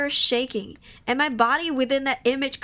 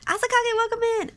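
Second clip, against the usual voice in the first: cheery welcome; 9 semitones higher, faster than usual. Real, not acted. Greets viewer.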